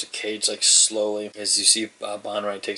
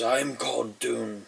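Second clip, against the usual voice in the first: slow, softer half-orc introduction; 7 dB quieter, slower than usual. Acted. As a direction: lightly play a half-orc giving his name.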